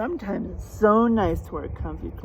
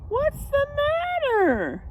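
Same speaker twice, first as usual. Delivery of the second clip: much higher, slow concerned dog; slower than usual. Acted. Reader voices the dog asking George, high and slow. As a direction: squeak it out as a worried little dog.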